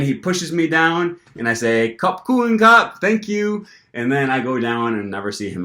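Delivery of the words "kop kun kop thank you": high cheerful thanks. Retold or imitated. Thanking him in Thai.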